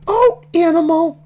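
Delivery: high, loud falsetto piggy voice; very high-pitched character exclamation; gushing baby Piggy. Acted. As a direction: coo it in a squeaky character voice. Piggy gushes apology to Animal.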